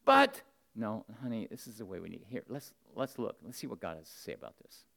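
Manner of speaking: soft, low, gentle persuasion of a wife; gentle coaxing. Pretend. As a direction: coax her softly, no pushing.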